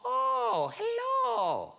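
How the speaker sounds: very high, singsong hello; high-pitched, drawn-out welcome; old woman's greeting. Acted. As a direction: answer the door in a high little-old-lady voice. Old woman answers the door.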